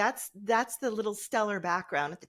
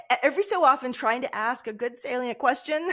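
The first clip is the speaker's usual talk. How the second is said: laughing; 7 semitones higher, 5 dB louder than usual. Real, not acted. Recalling banter on the show.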